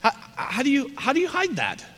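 high, incredulous question. Real, not acted. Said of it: "incredulous rhetorical".

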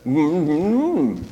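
wordless closed-mouth hum, swooping up then dropping; unintelligible mumble. Acted. Joke's pastor answers the puzzled fisherman, unintelligible muffled mumble. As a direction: mumble a whole answer through shut lips. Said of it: "can't understand what you're saying".

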